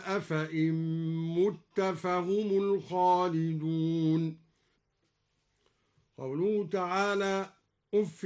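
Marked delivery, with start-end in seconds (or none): 0.0-4.4 مت read with damma on mim, slow chant; mutta with damma. Shown as a demonstration. Reciter demonstrating Duri reading, slow chanted مت. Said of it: "بضم الميم".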